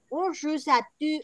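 put-on practising a French accent. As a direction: start a French greeting, cut short. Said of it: "que llevan acento gutural".

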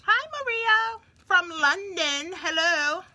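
high, drawn-out put-on London greeting; mock London accent. Greeting a viewer from London, in a teasing London accent. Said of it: "when people mock y'all accent".

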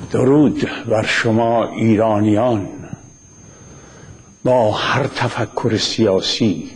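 formal address. Acted.

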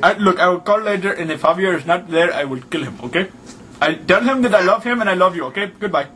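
put-on Arabian accent, lively and insistent; hammed-up Arabian-accented prank voice. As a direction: play an indignant caller with a thick Arabian accent.

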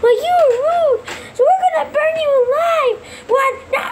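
high-pitched indignant threat; taunting child. Acted. Kids taunting their trapped enemy, high and indignant.

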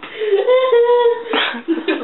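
high-pitched burst of laughter. Real, not acted. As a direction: squeal with laughter. Laughing after blinding joke.

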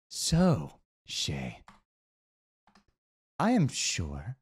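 a lightly voiced eerie stranger; sinister figure. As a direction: tempt them calmly. Mysterious figure offers a vessel.

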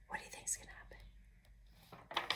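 hushed suspense whisper; storyteller whispering to the children; whispered question. A narrator's reading. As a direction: lean in and whisper.

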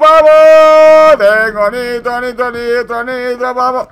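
hyped, loud and high; excited shout. Real, not acted. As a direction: yell it like a racing fan. Turbo boost, shouting hype.

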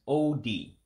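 O D with a long oh, then a sharp dee; Igbo pronunciation. Shown as a demonstration. Teaching viewers the Igbo word for shapes, careful pronunciation. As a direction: say O D as two drawn-out syllables, oh-dee. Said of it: "that's why you hear me saying O D".

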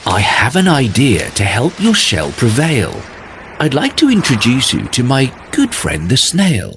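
spider voice. Acted. Spider explains her joy.

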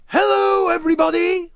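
very high, very loud sung-out greeting; booming. A narrator's reading. Wally greets the crowd in his garden, booming. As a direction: boom it out to the whole crowd. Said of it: "Wally boomed".

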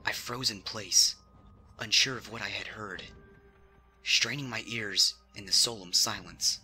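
plain reading voice

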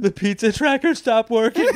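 high, whiny pretend crying; hammed-up tearful pizza complaint; mock weepy customer. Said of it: "me ordering a pizza".

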